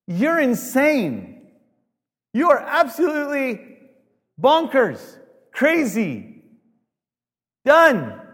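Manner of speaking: high, loud, slow, every word hammered; outraged shout, pitch climbing to the top; furious scream. Retold or imitated. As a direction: scream that he's lost his mind. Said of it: "it's a yell".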